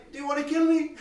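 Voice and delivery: high, loud, fast mock protest; mock outrage. Teacher joking to student about the program, high, fast mock outrage. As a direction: plead in hammed-up horror.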